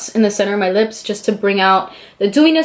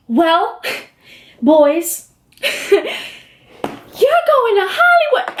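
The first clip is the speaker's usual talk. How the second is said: teasing pauses building to a high, drawn-out reveal; mock judge's big reveal; suspenseful announcement; 9 semitones higher, much slower than usual. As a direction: hold them in suspense, then sing out the news.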